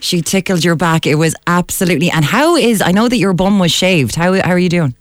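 ordinary delivery

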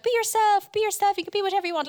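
high, loud, fast cheerleading; mock encouragement. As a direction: gush it like a pep talk. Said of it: "they're told".

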